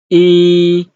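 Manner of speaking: E held long on a high, level pitch; sing-steady, loud E with no fall. Shown as a demonstration. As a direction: stretch E out high and even. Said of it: "the first tone".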